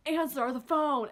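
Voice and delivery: lightly voiced mom, pressing each word; insistent mom. Mom insists her daughter pick up, lightly voiced insistence.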